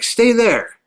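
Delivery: high-pitched call-out at normal volume; retold yell. Man ordering a waving stranger across the street, mock yell. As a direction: imitate yelling an order across a distance. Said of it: "So I yell".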